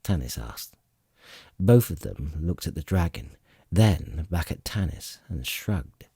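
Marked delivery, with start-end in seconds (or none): none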